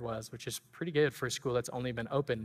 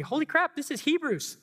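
much higher, thrilled; a coach remembering the race, much higher and excited; excited realisation; 7 semitones higher than usual. Retold or imitated. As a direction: relive the sudden spark of recognition.

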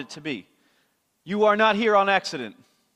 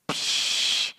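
mock hiss; 4 dB quieter than usual. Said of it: "and I go".

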